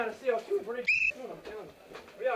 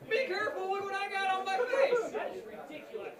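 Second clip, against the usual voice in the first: ordinary delivery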